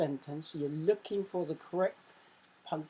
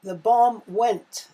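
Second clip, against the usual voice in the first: much louder, slow, suspenseful; dramatic build-up; 5 semitones higher, 12 dB louder, slower than usual. Shown as a demonstration. Teacher marking the quiz, reading the bomb sentence big and slow. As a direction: build to the explosion.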